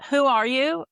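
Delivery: normal talking voice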